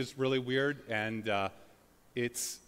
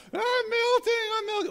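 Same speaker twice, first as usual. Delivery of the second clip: shrill, much higher mock wail; melting villain; 6 dB louder than usual. Preacher imitating a melting villain, high shrieking wail. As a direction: ham up the Wicked Witch melting in a shriek. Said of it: "villains when they fall into, like, a vat of acid".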